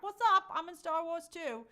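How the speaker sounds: high, loud, chummy hello; gamer greeting. Acted. Host plays a gamer greeting other players, hammed-up high hello. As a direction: call out a hammed-up friendly greeting. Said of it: "be like".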